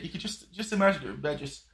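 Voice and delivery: normal talking voice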